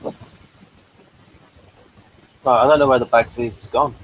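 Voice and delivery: ordinary talk, nothing marked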